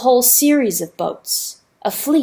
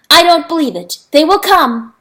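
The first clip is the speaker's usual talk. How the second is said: loud, higher stubborn denial; defiant insistence; 7 semitones higher, 9 dB louder than usual. Acted. Rooster denying the river, loud defiance. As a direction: refuse to believe it, loudly.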